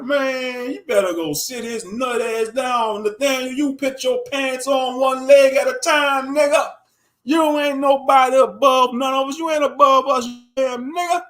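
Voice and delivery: high, loud scolding yell; angry callout. Pretend. Imagined heckler yelling at the leader to sit down, loud angry callout. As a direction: tell him off, full volume. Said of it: "I'd be like".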